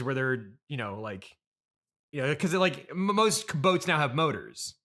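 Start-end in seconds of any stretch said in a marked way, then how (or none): none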